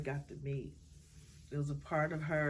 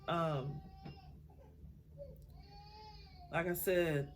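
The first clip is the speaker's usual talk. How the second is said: crying; 3 semitones higher, 3 dB quieter, slower than usual. Real, not acted. Tearful memory of her mother.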